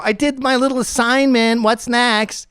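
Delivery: high eager teacher's pet. Retold or imitated. Teacher's pet reporting to the coach, high eager chirp. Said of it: "fucking pussy teacher's pet".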